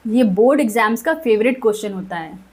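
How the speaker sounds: ordinary talk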